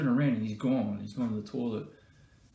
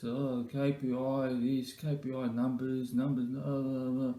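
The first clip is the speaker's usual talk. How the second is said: slow monotone drone about numbers; monotone meeting-bore impression; slower than usual. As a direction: imitate a mate droning on in monotone. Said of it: "in this monotone voice".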